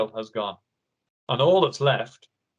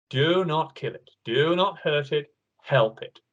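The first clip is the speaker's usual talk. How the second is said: ordinary talk, nothing marked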